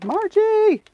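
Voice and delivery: high loud mock call; calling name. Imitating a viewer's call of Margie. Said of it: "I can hear her saying it".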